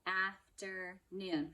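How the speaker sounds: Afternoon slow, each syllable apart; clapped out syllables. Shown as a demonstration. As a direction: clap Afternoon out slowly. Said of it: "Let's clap it out".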